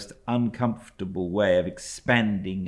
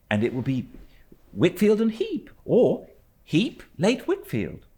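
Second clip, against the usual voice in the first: put-on much higher, louder boyish voice; a lightly voiced, higher young boy; young David; 13 semitones higher, 5 dB louder than usual. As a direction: tease him brightly like a boy.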